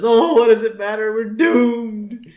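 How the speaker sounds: high, loud mock despair; Homer despairing. Castaway giving up on the raft, mock despair. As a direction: wail it in hammed-up hopelessness. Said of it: "And so Homer just says".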